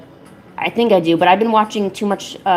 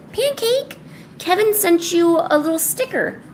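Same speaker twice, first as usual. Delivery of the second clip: high cooing announcement; 9 semitones higher than usual. Real, not acted. Owner tells the cat about a gift, high baby talk. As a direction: tell the cat good news way up high.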